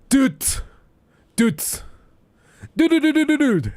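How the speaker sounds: very high, loud repeated dude; XQC dude. Retold or imitated. Imitating XQC's catchphrase, very high loud dudes. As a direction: bark dude over and over, hyper. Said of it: "talks like that".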